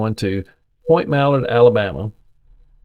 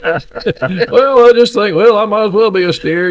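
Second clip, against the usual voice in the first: put-on high, louder, innocent old man reply; granddad answering; 9 semitones higher, 4 dB louder than usual. Grandson imitating granddad shrugging off the steer joke, high voice. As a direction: defend it like a clueless grandpa. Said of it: "We're like".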